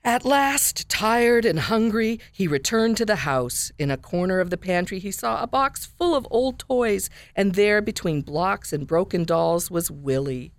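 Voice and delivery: lively storybook narration with big high character voices; reading a picture book aloud. A narrator's reading. Radio guest reads a children's book to listeners, animated storytelling. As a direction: read the story to the kids, bright and animated.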